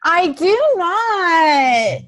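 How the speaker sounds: drawn-out, high, playful denial; mock protest. Real, not acted. [name] protesting at being called melodramatic, playful high whine. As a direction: protest dramatically, hamming it up.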